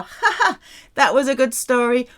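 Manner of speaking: a real laugh, then amused words; amused laugh over a good story. Shown as a demonstration. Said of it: "Ha ha, that was a good joke".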